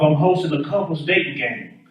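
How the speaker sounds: usual speaking voice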